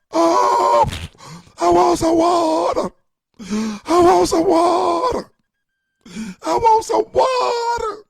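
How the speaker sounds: very high, loud, drawn-out mock wail; hammed-up scream. Neglected parent begs from the back room, mock screaming. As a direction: scream it like a helpless parent with a dry mouth. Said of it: "All time you could do is scream, blowing cracker dust".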